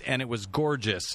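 ordinary talk, nothing marked

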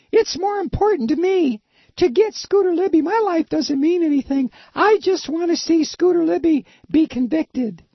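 breezy, self-sacrificing wife voice; high wife voice. Pretend. Acted skit of the wife.

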